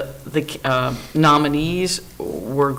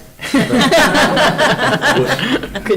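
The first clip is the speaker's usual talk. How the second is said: light laugh; 8 semitones higher, 3 dB louder than usual. Real, not acted. Colleague laughs after praise.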